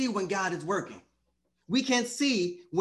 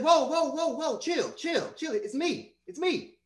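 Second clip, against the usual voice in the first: high-pitched, casual mock reassurance; acted calming down; 8 semitones higher, slower than usual. Preacher voicing Jesus to scared disciples, high comic calming. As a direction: comically hush a panic in a high voice.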